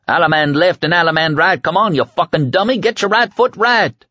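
high, hollered square-dance caller; jeering dance call. A narrator's reading. The kid heckles Trash as he pushes, hollering dance calls. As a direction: holler the calls, mocking the struggling worker.

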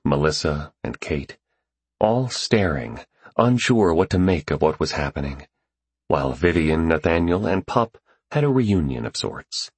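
ordinary delivery

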